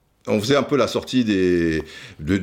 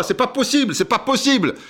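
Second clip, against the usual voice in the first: amazed disbelief; 11 semitones higher than usual. Real, not acted. Amazed at record speaking rate.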